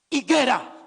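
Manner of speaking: sharp, loud order; voice of authority. Acted. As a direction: summon it with command. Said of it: "una voz de autoridad".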